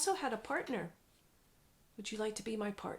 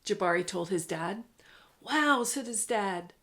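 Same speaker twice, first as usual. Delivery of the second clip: lively read-aloud storytelling voice; story narration; 4 dB louder than usual. A narrator's reading. Parent reading a picture book to a child, animated narration.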